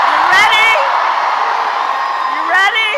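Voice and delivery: loud rising call to the crowd; rally shout. Real, not acted.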